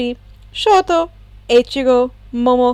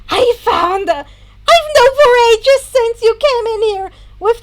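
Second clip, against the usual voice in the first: excited; 11 semitones higher, 6 dB louder than usual. Acted. Mei excitedly screeching on.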